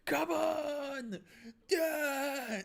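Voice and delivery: high, hammed-up cry of "come on, yeah"; mock self-cheer. Fan imitating the wrestler cheering herself on, high and hammed-up. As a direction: play the wrestler pumping herself up.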